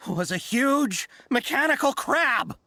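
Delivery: dramatic reveal. Acted. Merchant reveals the thief.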